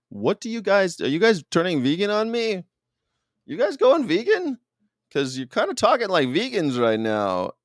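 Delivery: high, incredulous questioning; retold astonished teasing; mock surprise. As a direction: act amazed, pitch climbing way up.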